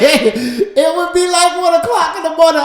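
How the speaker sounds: laughing through the words, voice jumping up; giggling it out high and loud. Real, not acted. Husband laughing while telling his wife about late talks.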